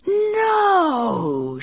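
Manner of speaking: high cry, then slow snake voice; basilisk. A narrator's reading. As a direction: refuse like an impatient serpent. Snake refuses.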